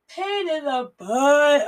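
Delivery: drawn-out, high whiny moan; whiny complaint. Real, not acted. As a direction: whine it out, fed up. Whiny repeat of own phrase.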